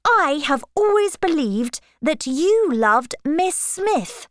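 high-pitched protest; indignant cry. A narrator's reading. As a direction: protest in astonishment, pitch climbing. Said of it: "cried Emma".

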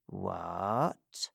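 faint, stunned whisper-thin What; barely able to get the word out; weak voice. Acted. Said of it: "he said in a weak voice".

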